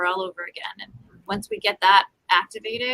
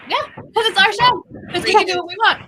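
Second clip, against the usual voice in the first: 1 gleeful boast; 11 semitones higher, 5 dB louder than usual. Real, not acted. Laughing boast about own show.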